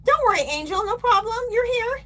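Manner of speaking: high, bright reassurance; cheerful reassurance. Real, not acted. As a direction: brighten up to welcome her. Reassuring a viewer warmly.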